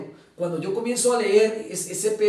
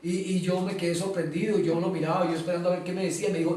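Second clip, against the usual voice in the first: usual speaking voice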